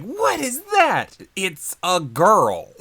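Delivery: loud, high, slowly stretched exclamation; hammed-up shock. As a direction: imitate someone aghast, dragging out each word. Acting out someone's shocked reaction.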